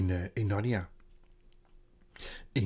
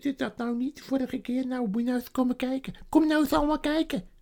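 high, louder stammering beaver voice; a flustered beaver calling the family out; high-pitched excited beaver; 14 semitones higher, 5 dB louder than usual. Acted.

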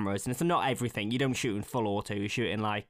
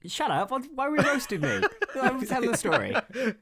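higher and louder, laughing as he protests; 12 semitones higher, 3 dB louder than usual. Real, not acted.

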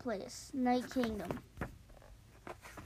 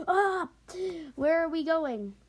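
startled wake-up; 5 semitones higher, 3 dB louder than usual. Acted. Woken by blankets ripped off.

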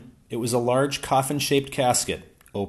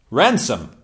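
exclaimed; 3 semitones higher, 8 dB louder than usual. A narrator's reading. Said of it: "I exclaimed".

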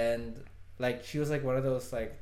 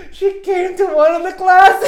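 laugh-crying; 15 dB louder, slower than usual. Real, not acted. Embarrassing reveal, laugh-crying.

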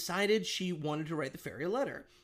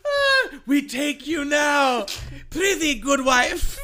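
loud, slow olde-timey colonial voice; hammy stage actor; 8 semitones higher, 8 dB louder, much slower than usual. Acted. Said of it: "imagine they're in, like, old colonial times".

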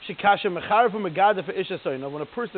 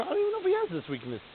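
high, quick voice of doubt; sudden realization; 12 semitones higher, 3 dB quieter, faster than usual. Acted. Letter writer doubting their own accusations, high-pitched backtracking. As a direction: rush it out high, backing off the accusation. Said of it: "Then the next thing you realize".

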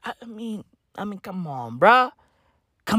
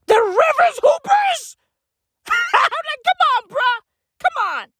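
high, loud shriek breaking into laughter; incredulous outburst; 5 dB louder than usual. Real, not acted. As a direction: shout it in disbelief, then crack up. Incredulous outburst at team name.

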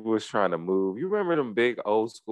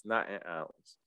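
much softer repeat, almost under breath; quiet echo; 11 dB quieter than usual. Shown as a demonstration. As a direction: drop it to a near-murmur.

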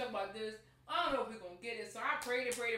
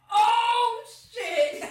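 loud high shriek of surprise; shocked shout; 13 semitones higher, 13 dB louder than usual. Retold or imitated. Mom pulls out envelope, shocked scream. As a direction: yell in disbelief.

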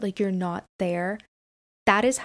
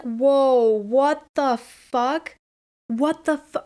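loud, drawn-out stunned whoa; shocked; 4 semitones higher, 6 dB louder, much slower than usual. Retold or imitated. Hearing a friend describe a dead relative, loud shock. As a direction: blurt it out in disbelief.

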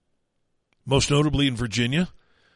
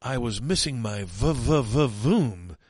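slow stuttered v-v-v-voom; 2 semitones lower, much slower than usual. Retold or imitated. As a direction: drag out the v-v-v-voom. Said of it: "the fruit and vegetable gal who says".

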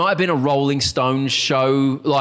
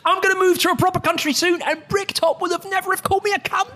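high laughing complaint; faster than usual. Retold or imitated. Retold resigned thought.

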